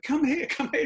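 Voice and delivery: imitating a kindly Italian lady, pitched high; Italian landlady beckoning the band; Italian accent. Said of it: "in her Italian accent".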